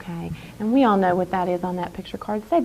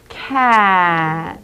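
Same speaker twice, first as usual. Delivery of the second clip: each sound drawn out slowly; 6 dB louder than usual. Shown as a demonstration. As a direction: stretch every sound of cat. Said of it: "Watch me as I build the word cat".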